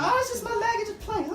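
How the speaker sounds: high, airy voice, playing innocent; mock innocent excuse. To girlfriend, caught faking a limp, a high mock-innocent excuse. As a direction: squeak it out like nothing's wrong.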